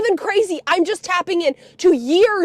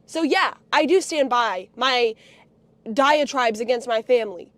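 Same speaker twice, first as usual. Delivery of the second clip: usual speaking voice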